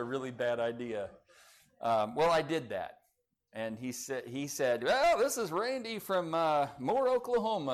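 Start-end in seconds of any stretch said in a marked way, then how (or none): 4.8-7.7 put-on pitched-up, light Oklahoma accent; a quick, rough try at an Oklahoma accent. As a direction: caller introducing himself in an Oklahoma accent. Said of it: "his little Oklahoma accent".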